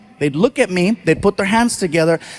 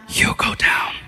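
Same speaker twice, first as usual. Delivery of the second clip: ominous whisper; 3 dB quieter than usual. Retold or imitated. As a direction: drop to a dark whisper on the last words. Ominous end of warning.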